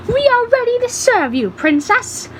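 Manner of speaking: very high, eager announcement; eager proclamation. A narrator's reading. Papyrus offers service to the princess, eager proclamation. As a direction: proclaim it in a high-pitched character voice.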